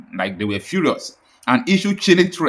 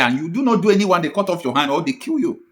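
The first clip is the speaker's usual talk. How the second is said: ordinary delivery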